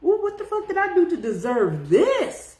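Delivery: hammed-up pearl-clutching shock; mock clutching your pearls. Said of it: "you clench your pearls a little bit like".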